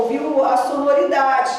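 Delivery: normal talking voice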